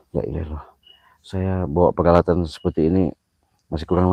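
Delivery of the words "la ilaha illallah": breathless whisper; praying quietly in the dark; whispered prayer. Real, not acted.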